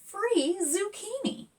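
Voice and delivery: put-on high delighted girl's voice; excited girl. Zora discovering free plants, thrilled and high. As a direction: read the sign thrilled.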